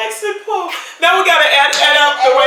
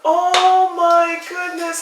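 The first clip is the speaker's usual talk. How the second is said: ordinary talk, nothing marked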